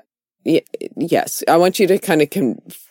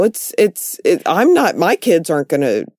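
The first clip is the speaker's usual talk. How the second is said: ordinary talk, nothing marked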